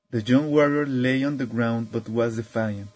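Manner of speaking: ordinary delivery, nothing marked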